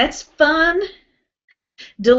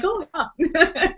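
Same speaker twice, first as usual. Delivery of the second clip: put-on higher, playful North Dakota accent with laughter; 6 semitones higher, 3 dB quieter than usual. Retelling his call to his mom, mock North Dakota accent. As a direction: imitate North Dakota through a giggle. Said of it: "North Dakota voice".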